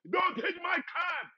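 high, slow mock shout; retold shout. Angry pedestrian yelling at an inching cab, mock shout. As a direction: drag out a furious shout.

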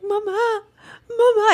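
high-pitched imitation of a toddler's wail; mock crying. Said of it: "it's not even, like, crying like".

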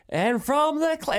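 high, drawn-out racing call impression; T Lee. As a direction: imitate a race caller lifting for the finish. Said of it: "you've got T Lee".

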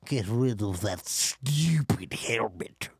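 slow raspy whisper, menacing; Snoke impression. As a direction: whisper it slowly like the sinister Snoke. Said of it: "How does Snoke talk?".

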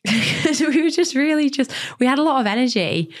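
giggling through the words; high laughing voice. Real, not acted. Laughing while explaining nickname.

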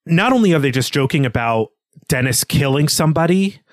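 ordinary delivery

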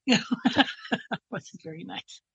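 laughing softly through the words. Real, not acted. Patient laughs telling the helicopter nurse story, laughing. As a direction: chuckle it out.

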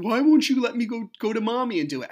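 loud, high mock wail; imitated crying child. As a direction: plead like an upset little boy, imitated. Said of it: "crying, going".

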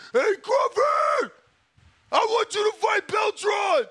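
playing a fan shouting a challenge at a fighter; mock fan shout. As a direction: yell it like a fan at ringside. Acting fans calling out Crawford.

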